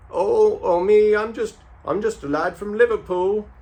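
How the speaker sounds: high, loud put-on Liverpool accent; mock Scouse impression. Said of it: "that's a nice accent you got".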